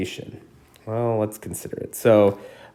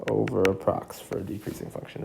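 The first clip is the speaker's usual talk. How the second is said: softer, throwaway recap of "Over approx"; quiet repeat; 7 dB quieter than usual. Shown as a demonstration. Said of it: "is an over approximation".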